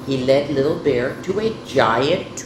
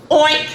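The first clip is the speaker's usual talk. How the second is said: high, loud oink; short imitated pig sound; 10 semitones higher, 5 dB louder than usual. A narrator's reading. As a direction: oink once like a piglet. Piglet's answer in story.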